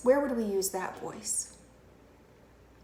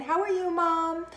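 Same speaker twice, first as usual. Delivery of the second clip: lightly acted girl on the phone to mom; higher, cheery-call pretend voice; inside voice phone chat; 7 semitones higher, 5 dB louder than usual. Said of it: "use her inside voice and just talk and say hi".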